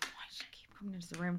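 fast, hushed retold aside; whispered grumble. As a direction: whisper it quickly to yourself. Retold private thought about mom.

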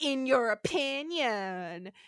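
slow, drawn-out taunt; mock retort. Host voices lawyer dismissing Spock's claim, mock scornful. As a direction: stretch each word in mock disdain. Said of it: "it's more fun to say it that way".

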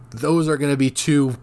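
talking through hiccups. Real, not acted. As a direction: hiccup breaks into the words. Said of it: "I got the hiccups there".

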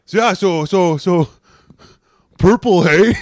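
loud, high mocking tease; teasing old guy. Old school guy teasing the purple shirt, loud and mocking. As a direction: needle him about the shirt.

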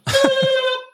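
sudden high whooping laugh; loud burst of laughter. Real, not acted. Caught out by his own timing, loud high laugh.

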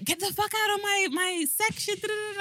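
high-pitched, half-volume mock shriek; retold screaming. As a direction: imitate screaming in a high, held-back voice. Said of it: "like, screaming, whatever".

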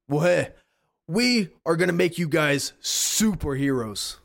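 rally the trainees like a big hero boss; hero mentor voice. Acted. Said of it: "And he says".

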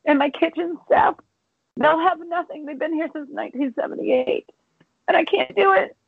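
high, breaking voice on the edge of sobbing; choking back real tears for her staff; tearful, voice cracking. Real, not acted. Owner telling the host her staff will have nothing, crying. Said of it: "Don't make me cry".

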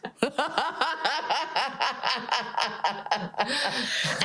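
real, rolling belly laugh; long hearty laugh. Real, not acted. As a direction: laugh it out at length after the punchline. Laughing after own punchline.

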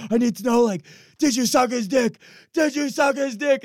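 high, loud, desperate pleading; hammed-up frantic demand. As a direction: beg for the answer in a high panic. Acts out his frantic interrogation.

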